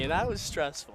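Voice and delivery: ordinary talk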